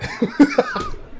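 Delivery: high, loud burst of laughing; real high-pitched laughing fit; burst of laughter. Real, not acted. Cracking up over funny clip.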